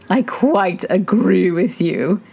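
lightly voiced old duchess; a hint of a fussy old duchess; frail withering duchess. A narrator's reading.

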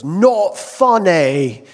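loud, drawn-out rock star put-down. Retold or imitated. Rock star rejecting a comedian's joke, loud mock disdain. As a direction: blare it slowly, stretching both words. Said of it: "[name] just looks at [name] and he goes".

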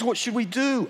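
high, loud anxious question; anxious pastor. Retold or imitated. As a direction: ask in a panic. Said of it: "He goes".

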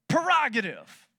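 Prerogative said "puh-ROG-ative"; slow, loud Prerogative with the first syllable slurred to "per"; common mispronunciation. Shown as a demonstration. Preacher showing how he always said it, Prerogative as "per-ROG-ative". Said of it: "I pronounce it prerogative".

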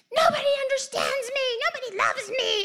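hammed-up toddler wail; mock wailing child. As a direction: imitate a three-year-old sobbing tantrum. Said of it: "she'd be like".

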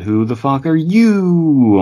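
high, slow, startled yell. Retold or imitated. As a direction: yell it in shock at an intruder. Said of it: "jumped back a bit and yelled".

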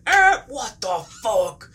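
slow, high, loud driver outburst; hammed-up shock at hitting something; startled angry yell. Driver hits the taped egg, startled mock yell.

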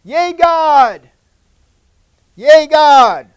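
put-on high, loud children's cheer; mock cheer. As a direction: shout it like excited kids. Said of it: "they were kinda like saying".

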